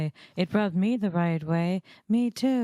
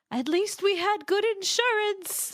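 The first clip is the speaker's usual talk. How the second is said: high character voice; 13 semitones higher than usual. Retold or imitated. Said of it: "in the dub, you have, she says, like".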